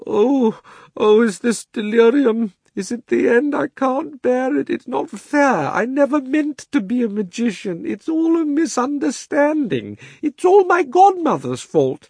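high, drawn-out self-pitying wail. A narrator's reading. Uncle Andrew protesting in the darkness, wailing. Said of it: "it was wailing on".

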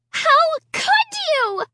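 high shrill accusing scream. A narrator's reading. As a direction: scream it in horror. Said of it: "Sarah screamed".

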